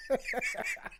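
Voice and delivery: very high squeal of laughter. Real, not acted. Streamer cracking up at the widower's reply, squealing with laughter. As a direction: let a helpless shriek of laughter escape.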